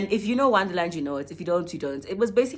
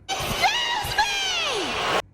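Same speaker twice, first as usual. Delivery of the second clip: very high, drawn-out excuse me; hammed-up offended squeal; mock indignant shriek; much slower than usual.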